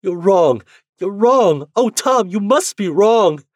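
high, loud sobbing denial; sobbing protest. Acted. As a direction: cry it out in desperate disbelief. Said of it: "She was crying, great sobs".

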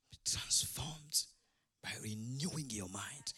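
low, soft, deliberate near-whisper; hushed and weighty; hushed scripture. Real, not acted. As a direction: drop low and quote it slowly, with weight. Preacher quotes verse with weight.

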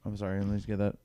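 ordinary talk, nothing marked

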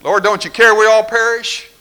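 high, loud frantic cry to the Lord; mock panicked plea. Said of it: "The disciples have been doing that".

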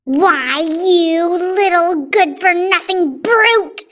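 loud, slow, outraged shout; angry shout. A narrator's reading. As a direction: shout the insult, leaning on each word. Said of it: "Aunt Spiker shouted".

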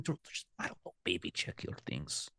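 hushed warning whisper. Acted. Secretive warning to the party.

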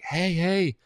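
high, put-on hailing call; mock call to a passing neighbor; imitated calling out. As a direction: wave someone down with your voice. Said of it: "She's, like, waving me down".

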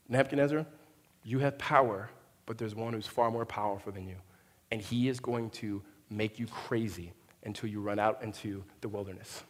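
low, hushed word of warning; lightly acted solemn prophet, fading away; Daniel's grave warning. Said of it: "This is what he says".

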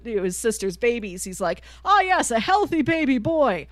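ordinary talk, nothing marked

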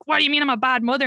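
high, fast accusing retort; quick-fire, overwrought indignant question; mock offended. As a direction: imitate a mother taking sudden offence. Mother's sudden accusation, acted.

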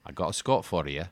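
put-on light Scottish accent; a quick, lightly voiced Scottish line. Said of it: "I needed to do a few accents".

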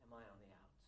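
worried quiet aside. Retold or imitated. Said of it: "looking around going".

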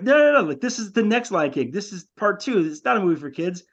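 high, rushed, overexcited pitch; excited insisting. Retold or imitated. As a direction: sell the sequel wildly.

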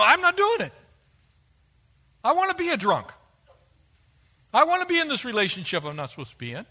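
defiant sinner. Acted. Preacher voicing a sinner who refuses to submit, defiant. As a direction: act out a stubborn rebel refusing God.

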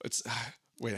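ordinary delivery

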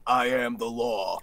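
slow low gruff movie cop; Clint Eastwood impression. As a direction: growl it like Clint. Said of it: "Clint Eastwood going".

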